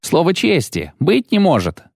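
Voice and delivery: high-pitched amazement; astonished disbelief. A narrator's reading. Knight astonished on seeing Geralt, high disbelief. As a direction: exclaim it, unable to believe. Bull's head cannot believe it.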